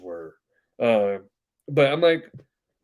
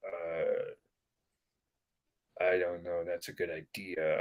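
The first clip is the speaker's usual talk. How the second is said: hesitant coworkers; 9 semitones lower, 12 dB quieter, slower than usual. Retold or imitated. Coworkers' unsure reply mocked.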